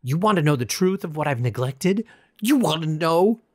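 loud, high furious taunt; raging. A narrator's reading. Raging at a lifeless statue, furious taunt. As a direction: challenge the statue, voice climbing. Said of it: "raging like a madman".

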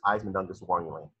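ordinary talk, nothing marked

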